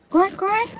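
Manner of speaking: high squeaky duck quack; mock quack. Master echoing the fox's call, high playful quacking. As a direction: copy the animal's call playfully.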